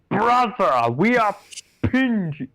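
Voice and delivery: high, loud gruff soldier shout; Space Marine voice. Acted. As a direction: bark it like a battlefield marine. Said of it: "give him a Space Marine voice".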